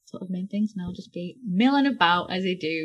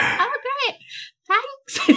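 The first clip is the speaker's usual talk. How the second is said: high, squeaky acted-out thanks; put-on, high-pitched polite gratitude; mock delight; 4 dB louder, slower than usual. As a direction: feign pleasure in a much higher voice. Retold awkward reply to being caught out.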